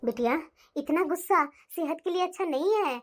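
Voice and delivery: low, calm mother voice; mother calming her angry daughter, low and gentle; soothing mother. Acted.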